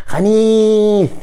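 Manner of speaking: high, stretched mock خنيث; mimicking a friend's slur. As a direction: squeal خنيث high and drawn out, aping a friend. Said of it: "مرة تخ". (تقليد ساخر لكلمة خنيث عالي وممطوط)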